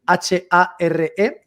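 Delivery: each letter spelled slowly and separately; spelling out letters. Shown as a demonstration. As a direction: spell the name out letter by letter, very slow. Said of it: "se llama Dani".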